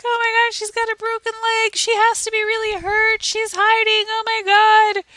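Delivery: re-live the panic about the hurt cat; retold freak-out. Panicking that the cat's leg is broken. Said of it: "I'm freaking out. I'm like".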